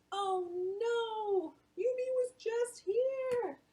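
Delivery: high, slow dismayed voice; grandma laments the near miss; high sighing grandma, slow and sorry; disappointed grandma. Acted. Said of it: "Grandma said the same thing".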